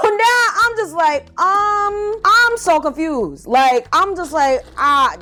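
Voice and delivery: high, drawn-out bafflement; baffled confusion. Retold or imitated. Storyteller replaying her reaction to bed bugs, baffled. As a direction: stretch it out high, utterly lost.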